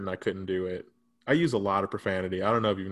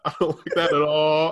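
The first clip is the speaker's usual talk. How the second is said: higher and louder through laughter; laughing; 6 semitones higher, 7 dB louder than usual. Real, not acted. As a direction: laugh out the disapproval. Laughing at the story.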